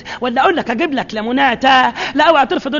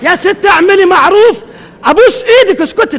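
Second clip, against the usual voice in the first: slow, loud, higher pleading yell; exasperated shout; 6 semitones higher, 7 dB louder, slower than usual. Acted. The neighbor yells at the chatty woman to hush, exasperated shout. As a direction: yell at her to finally stop. Neighbor loses patience, begs silence.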